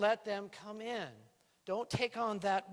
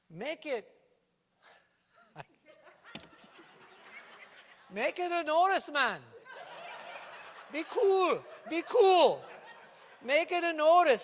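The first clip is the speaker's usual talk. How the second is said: put-on Jamaican accent; 8 semitones higher, 9 dB louder than usual. Jamaican bit with laughter.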